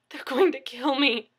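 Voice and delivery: high, loud burst of panic; panicked gasp. A narrator's reading. Injured man finally answers his neighbor, panicked gasp. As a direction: let the terror bubble out. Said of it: "he gasped".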